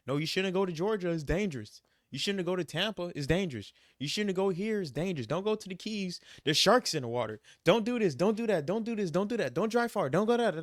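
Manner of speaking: high-pitched mock mom warnings, tumbling on in a list; nagging worried mother. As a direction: imitate a fretting mom piling up don'ts. Imitating his mother's worried warnings.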